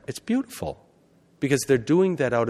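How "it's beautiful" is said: much higher, softer and awed; admiring inner voice. Retold or imitated. Preacher voicing his awe at Sabbath devotion. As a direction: let it float up in quiet wonder. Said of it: "part of me is like".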